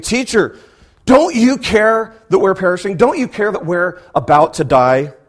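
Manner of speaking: high, loud frightened cry of the disciples; panicked plea. Acted. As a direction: plead as if the boat is sinking. Disciples waking Jesus in panic.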